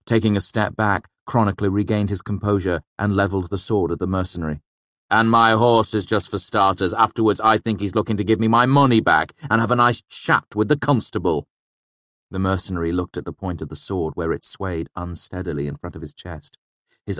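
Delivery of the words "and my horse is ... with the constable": raised, louder confrontational voice; indignant threat. A narrator's reading. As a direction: press your demand on the thief. Robbed man threatens thief at swordpoint.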